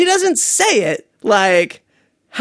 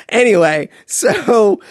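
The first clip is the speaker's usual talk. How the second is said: usual speaking voice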